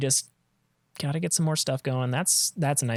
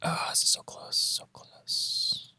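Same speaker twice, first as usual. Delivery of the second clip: tense whisper; 4 dB quieter, slower than usual. Real, not acted. Tense moment, game nearly decided.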